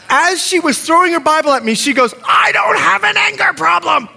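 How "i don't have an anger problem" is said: high-pitched yelled denial; imitating an enraged student; mock furious shout. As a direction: yell it in hammed-up rage. Acting student yelling while throwing Bible.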